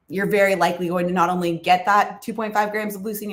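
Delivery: normal talking voice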